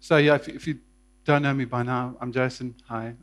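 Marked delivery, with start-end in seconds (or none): none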